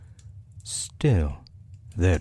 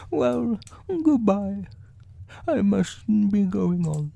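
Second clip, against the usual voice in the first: unhurried storybook bear, pitched high; Pooh; 13 semitones higher than usual. A narrator's reading. Narrator voices Pooh leaving.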